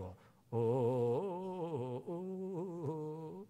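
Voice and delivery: long, drawn-out wailing moan; imitated funeral keening. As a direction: mimic a mourner's slow chanted wail. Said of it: "그 목소리 좋아야 됩니다".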